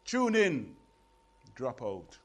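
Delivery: rhythmic, punchy dub poetry recital with rising intensity; performance poem. Acted. Poet performing his radio poem to a live audience, rhythmic recital. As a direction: drive the verses like a chant to the crowd.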